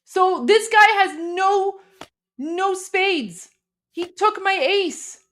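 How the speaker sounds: high, loud disbelieving cry; shocked outrage. Real, not acted. Opponent trumps her ace.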